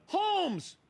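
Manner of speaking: one high, loud shouted name; yelled name. Retold or imitated. Crew member shouting the MVP's name to the host, high yell. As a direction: call it out across the room. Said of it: "somebody yells out".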